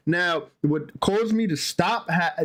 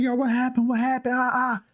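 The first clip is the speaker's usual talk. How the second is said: imitated alarm; 7 semitones higher than usual. Relatives finding a crying child, imitated alarm. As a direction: mimic worried relatives in a raised pitch. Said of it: "They're like".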